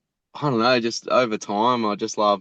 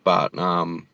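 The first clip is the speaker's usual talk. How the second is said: ordinary delivery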